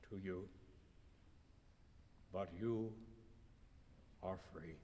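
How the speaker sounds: very soft gentle voice; gently. A narrator's reading. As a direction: release her kindly. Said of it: "saying gently".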